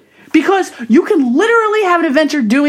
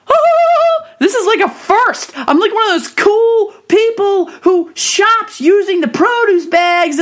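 high, thrilled, a bit slower; excited squeal; 8 semitones higher, slower than usual. Retold or imitated. Proud shopper gushing over produce bags, high and excited. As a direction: gush like it's a big milestone. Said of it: "And I'm like".

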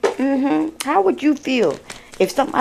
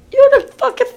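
exasperated outburst; 7 dB louder, faster than usual. Real, not acted.

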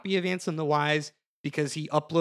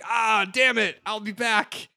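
mock villain; 6 semitones higher, 4 dB louder than usual. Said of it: "so he's like".

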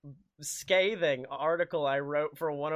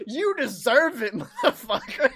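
laughing taunt; 6 semitones higher, 5 dB louder than usual. Shown as a demonstration.

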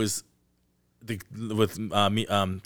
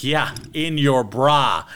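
drawn-out, loud and higher; slowly milk the cheeky line as the lawyer; lawyer's cheeky comeback, slow and loud; slow mock leer; 5 semitones higher, 7 dB louder, much slower than usual.

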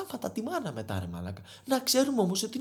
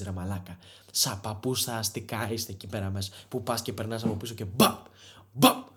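low-voiced mock scolding of club kids; low grumbling rant; 11 semitones lower than usual. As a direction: mutter like a grumpy old man.

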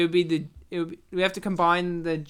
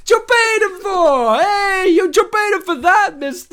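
Italian New Yorker; 10 semitones higher, 8 dB louder than usual. Retold or imitated. Host hamming the name as an Italian New Yorker. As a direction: shout it like a hammed-up Italian New Yorker on the street. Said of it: "It's like an Italian New Yorker".

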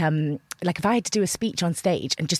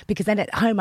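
ordinary talk, nothing marked

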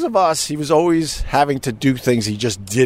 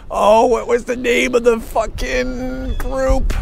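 high, drawn-out frustration; exasperated memory lapse, pitched high; straining to remember; 12 semitones higher, slower than usual. Real, not acted. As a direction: grope for the name, stretching the words.

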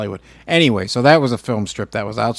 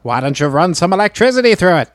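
infomercial pitchman; 7 semitones higher, 4 dB louder than usual. Acted. Mock sales pitch continues.

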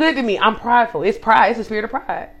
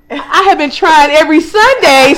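loud, high, drawn-out disbelief; incredulous, punched-out complaint; exasperated outburst; 8 semitones higher, 7 dB louder, slower than usual. Real, not acted. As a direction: shout each chunk slowly in exasperation. Exasperated punchline about the car.

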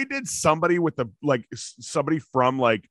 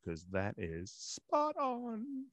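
put-on very slow, soft, drawn-out British accent; mock British accent; 8 semitones higher, 14 dB quieter, much slower than usual. Co-host bragging about accents, mock British accent. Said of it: "except for my British accent".

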